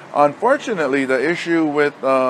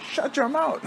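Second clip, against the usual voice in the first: mock scold; 12 semitones higher, 5 dB quieter than usual. Coach silencing kids.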